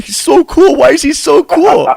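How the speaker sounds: high, loud mock gushing; mock envious friends. Friends envious about the Hoodie interview, high loud mock gushing. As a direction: squeal it like starstruck buddies. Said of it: "And everybody's like".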